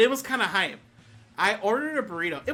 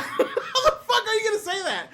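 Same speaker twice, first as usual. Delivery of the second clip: high-pitched retold protest through laughter; laughing mock outrage; 12 semitones higher, 3 dB louder than usual. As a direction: protest it while cracking up. Retelling argument while laughing.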